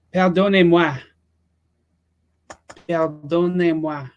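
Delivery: put-on joking French accent, drawn out. Streamer excusing himself to chat in a French accent. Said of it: "It's my French accent".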